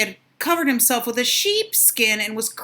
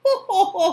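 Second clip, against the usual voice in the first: high ho ho laugh; mocking laugh; 10 semitones higher, 6 dB louder than usual. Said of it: "laughed the second little pig".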